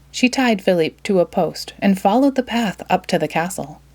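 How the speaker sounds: usual speaking voice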